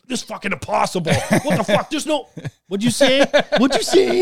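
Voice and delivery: high, rising, hammed-up incredulous outburst; retold shock, voice jumping up; stunned disbelief. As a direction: build to a loud, laughing 'come again?'. Retold shocked reaction to test results.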